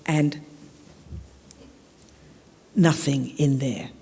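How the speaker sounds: ordinary talk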